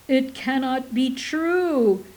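loud, slow, high wail; a hammed-up wail at being told to leave; stentorian wail. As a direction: wail it out in despair. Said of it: "Minkatul wailed in stentorian tones".

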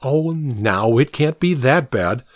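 ordinary delivery

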